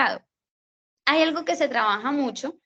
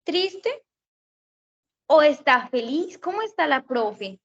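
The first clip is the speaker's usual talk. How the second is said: put-on teacher quizzing kids; 4 semitones higher than usual. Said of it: "O uno les pregunta".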